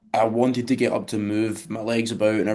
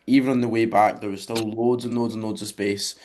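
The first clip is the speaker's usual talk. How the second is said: ordinary delivery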